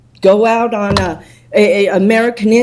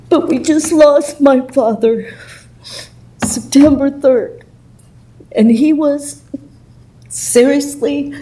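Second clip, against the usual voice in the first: emotional; 4 semitones higher than usual. Real, not acted. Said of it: "I'm sorry I'm a little emotional".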